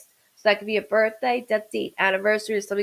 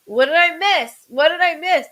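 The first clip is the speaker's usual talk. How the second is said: higher and louder, bursting in curious; eager young spirit; 6 semitones higher, 6 dB louder than usual. Retold or imitated. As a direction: pop in eager to know what you missed. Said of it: "a younger soul just walked in, like".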